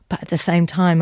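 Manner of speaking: normal talking voice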